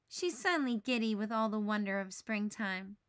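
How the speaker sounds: ordinary delivery, nothing marked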